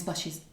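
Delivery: "buses" said as "bushes", with sh in the middle; "buses" with a shushy middle; slurred "buses". Real, not acted. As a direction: slur the s in "buses" into a sh. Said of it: "but a bit more shushy".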